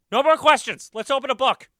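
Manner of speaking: high, loud flustered shout; panicked yell. A narrator's reading. Teacher cutting off questions about marriage, panicked yell. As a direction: yell to shut the class down. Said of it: "and I yelled".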